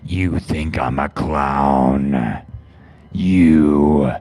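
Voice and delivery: low, slow, menacing killer clown; creepy clown voice. Acted. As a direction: drag each word out like a predatory clown luring a child. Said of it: "that wasn't a voice changer".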